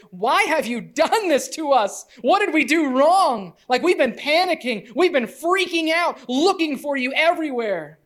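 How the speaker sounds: a worried mother letting out days of panic; distressed mother. Retold or imitated. Mary to the lost boy Jesus, frantic and distressed.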